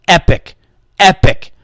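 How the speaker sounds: two loud falling raves; emphatic praise. Real, not acted. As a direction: land each word like a rave review. Raving about the enchiladas.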